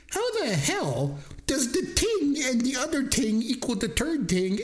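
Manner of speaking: high, slow, disbelieving outburst; mock outrage at the campfire, pitched high; incredulous. Incredulous retort to her.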